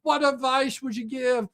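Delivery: high, loud, overeager gushing; gushing mock excitement. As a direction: squeal it like she's thrilled for validation. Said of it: "she almost wet herself".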